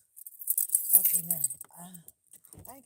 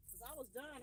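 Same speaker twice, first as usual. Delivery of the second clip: ordinary talk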